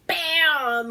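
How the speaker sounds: punched-out sound effect; explosive storytelling bang; loud impact word. Retold or imitated. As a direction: hit it high and loud like a crash. Sound effect of slamming into display.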